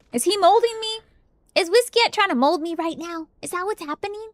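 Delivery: high squeaky panicked questions; mock paranoid squeak. Real, not acted. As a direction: whine it high and worried.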